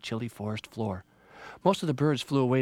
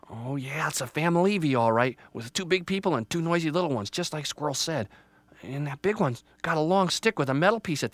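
put-on slightly raised childlike storybook voice; hopeful, nervous Little Tree; 5 semitones higher than usual. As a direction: wonder aloud like an anxious little tree. Little Tree watches people approach.

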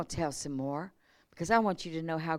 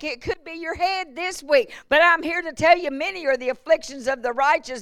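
higher, louder preaching build; full-force preaching; 8 semitones higher, 5 dB louder than usual. Real, not acted. Pastor urging the church in a higher, louder preaching voice. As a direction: preach it out, rising and pushing.

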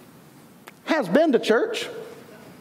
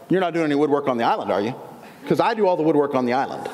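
low, quick imitation of a territorial local; territorial, standoffish; 6 semitones lower, faster than usual. Island woodworker to possible rival, mock territorial. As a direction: warn a newcomer off your turf, mock-suspicious. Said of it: "they were very territorial and standoffish".

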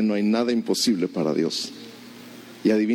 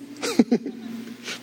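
breathy high giggle; high laugh; 3 dB quieter than usual. Real, not acted. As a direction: laugh at the question. Laugh before repeating.

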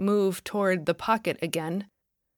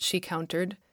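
plain reading voice

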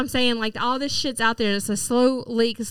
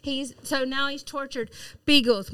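ordinary talk, nothing marked

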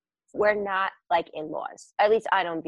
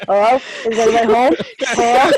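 louder imitated call into the house; 8 dB louder than usual. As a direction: call through the window. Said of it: "talking in the front window".

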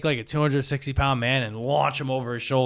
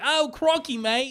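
high mock Aussie exclamation; hammed-up Australian woman's voice, pitched up; Australian lady cop; 11 semitones higher, slower than usual. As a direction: exclaim as a caricature Aussie policewoman. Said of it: "I think whenever she's getting fucked".

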